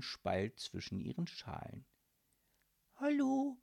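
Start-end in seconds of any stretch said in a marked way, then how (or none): 3.0-3.6 put-on higher, curious child's hello; surprised little girl. Girl spotting eyes in a shell, surprised and high. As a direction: pitch it up like a small girl. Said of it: "sagte Anne erstaunt".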